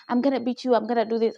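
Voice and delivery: plain reading voice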